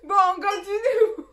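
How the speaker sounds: loud, high squeal through laughter; real laughter swallowing the words; laughing while talking. Real, not acted. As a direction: try to carry on mid-giggle. Trying to recover from laughing fit.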